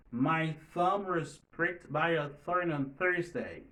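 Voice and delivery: slow model sentence, careful "thumb", "thorn", "Thursday"; th practice. Shown as a demonstration. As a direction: read slowly, placing each th in "thumb" and "thorn". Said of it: "c'est différents sons que T H". (li dousman, th nan "thumb", "thorn", "Thursday" byen klè)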